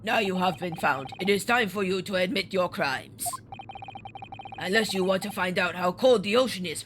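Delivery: accusing sailor with a put-on Russian accent. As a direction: attempt a heavy Russian accent. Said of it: "a Russian accent, but we'll try it".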